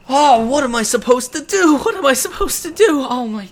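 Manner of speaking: high, loud, panicked wail; a distraught theater man despairing aloud, high and loud; frantic theater man. Acted. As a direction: wring your hands and wail to the sky. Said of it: "He says".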